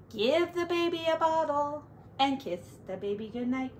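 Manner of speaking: plain reading voice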